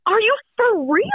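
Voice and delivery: high-pitched imitation of stunned disbelief; retold disbelief. Bride retelling her reply to the proposal, incredulous. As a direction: ask it high, acting out amazed doubt.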